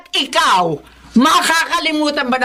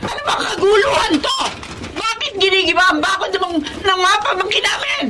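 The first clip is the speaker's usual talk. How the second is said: high, raised angry questioning; angry demand; 8 semitones higher than usual. Acted. Aunt confronting the neighbor over the broken fence, angrily. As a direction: demand answers, furious.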